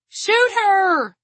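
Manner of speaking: very high, loud scream; panicked scream. A narrator's reading. Terrified guard begs gunman to fire, screaming. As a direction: scream the order in terror. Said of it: "Dawn screamed".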